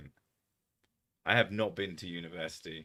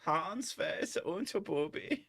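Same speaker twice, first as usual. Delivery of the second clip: put-on high-pitched hammy German accent; mock German accent; 9 semitones higher, 3 dB quieter than usual. Jokey mock-German call to Hans.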